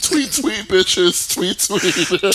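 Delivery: high, loud, sassy drag queen chirp. Pretend. Voicing drag queen Tequila Mockingbird.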